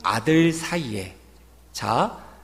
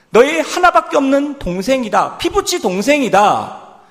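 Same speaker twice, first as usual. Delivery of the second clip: correcting father; 7 semitones higher, 7 dB louder than usual. Retold or imitated. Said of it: "아버지가 그 호칭을 교정하고 있습니다".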